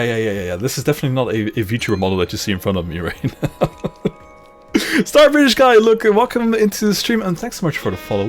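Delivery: ordinary talk, nothing marked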